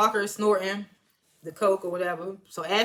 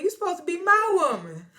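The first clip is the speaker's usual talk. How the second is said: movie quote; 10 semitones higher, 4 dB louder than usual. Retold or imitated.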